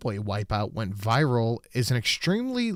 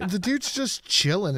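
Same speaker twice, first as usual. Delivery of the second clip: usual speaking voice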